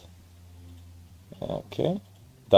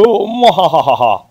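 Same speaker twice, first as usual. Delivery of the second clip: slow evil cackle; mad scientist laugh; 6 semitones higher, 4 dB louder, slower than usual. Pretend. Entering the house, villain laugh.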